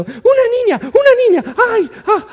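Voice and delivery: very high, loud squealing cockroach; cartoon cockroach screaming in fright. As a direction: play a tiny bug shrieking in panic. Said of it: "la cucaracha anda gritando".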